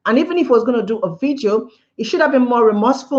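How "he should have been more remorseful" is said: ordinary delivery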